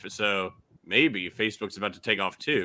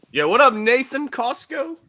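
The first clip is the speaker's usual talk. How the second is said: excited greeting; 6 dB louder than usual. Real, not acted. Greeting a friend who joins.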